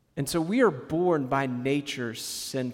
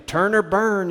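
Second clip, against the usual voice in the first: mock fiery preacher; 5 semitones higher, 5 dB louder than usual. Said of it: "when you hear a preacher say".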